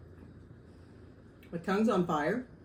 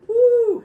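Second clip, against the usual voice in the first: 1 hot-pepper whoop; 12 dB louder than usual. Real, not acted. Burning mouth after hot salsa.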